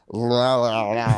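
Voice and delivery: mock babbling noise; imitated drunken babble. Drunk man when the cops came, mock babbling. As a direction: babble nonsense like a belligerent drunk.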